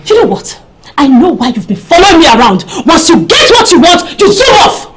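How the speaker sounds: heated, bitter rebuke; scolding, building to a shout; angry accusation. Acted. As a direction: throw the blame at them. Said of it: "don't sound like that".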